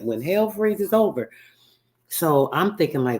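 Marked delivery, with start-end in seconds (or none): 0.0-1.3 grandmother's saying. Retold or imitated. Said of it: "I can remember her saying that".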